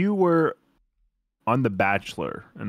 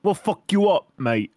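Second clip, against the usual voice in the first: high, hammed-up British threat; mock British lad; British accent; 7 semitones higher than usual. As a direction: play the British hard man, pitched up. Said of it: "How's my British accent?".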